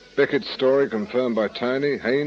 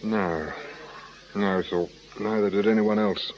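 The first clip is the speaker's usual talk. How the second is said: normal talking voice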